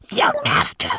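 tiny, extremely high manikin cry; small creature's urgent squeal. A narrator's reading. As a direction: squeak out a call for help. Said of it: "he cried".

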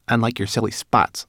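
offended little rat retort; spat indignantly. A narrator's reading. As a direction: fire back an insult. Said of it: "spat the rat".

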